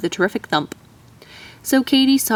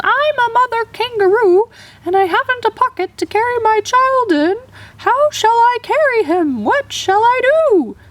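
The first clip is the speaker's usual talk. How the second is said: very high, loud desperate scream; screaming up at the owl; 4 dB louder than usual. A narrator's reading. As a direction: shout up the tree to a deaf owl. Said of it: "screamed at him".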